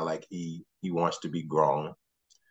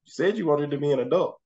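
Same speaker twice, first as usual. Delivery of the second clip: higher, playful gotcha tone; mock-stern teasing dad; 10 semitones higher, 3 dB louder than usual. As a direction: tease the kid with his own words. Dad teasing son back.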